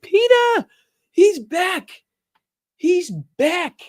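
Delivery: very high, loud playful falsetto; mock falsetto cry. Host messing with returning viewer, loud high falsetto. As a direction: squeal it out as a teasing announcement. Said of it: "I'm just messing with you".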